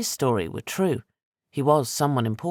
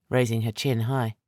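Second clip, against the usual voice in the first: plain reading voice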